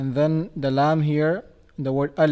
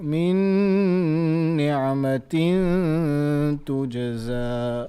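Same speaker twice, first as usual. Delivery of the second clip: melodic Quran recitation; 4 dB louder than usual. Shown as a demonstration. Teacher chants the ayah for the student to follow. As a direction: sing out the ayah with tajweed.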